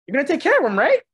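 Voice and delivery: high, loud mock-dad voice; imitated embarrassing dad. Dad to a girl in the elevator, imitated embarrassing dad. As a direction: play a dad loudly putting his kid on the spot.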